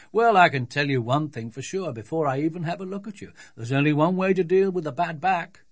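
loud, jovial physician; confident doctor. Acted. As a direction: declare it as a self-assured doctor.

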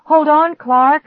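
high, loud, urgent call; attempted shout. A narrator's reading. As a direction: shout to him to hang on. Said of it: "I tried to shout".

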